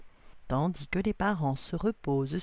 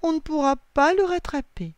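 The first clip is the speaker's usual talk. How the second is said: put-on high, louder childlike lament; 10 semitones higher, 6 dB louder than usual. A narrator's reading. Lily despairs of catching the hat, lamenting. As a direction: wail it in defeat. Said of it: "se lamente Lily".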